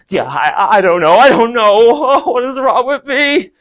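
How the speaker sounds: laughing, worked-up outburst; hysterical fluster. Acted. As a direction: spiral up into helpless disbelief. Fans react helplessly to the performer.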